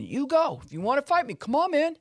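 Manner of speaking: high, goading schoolboy voice; taunting challenge. Retold or imitated. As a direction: egg him on to fight. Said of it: "one person says to the other person".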